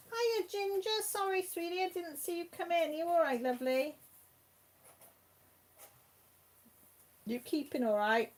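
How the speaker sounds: high sing-song voice for the cat; pet talk. Real, not acted. Greeting her cat.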